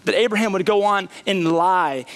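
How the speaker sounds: ordinary delivery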